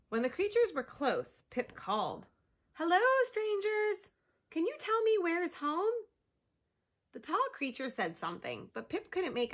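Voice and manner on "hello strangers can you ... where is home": high, loud call to strangers. Acted. Pip calling to the sled dogs, high and loud. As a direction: call out hopefully.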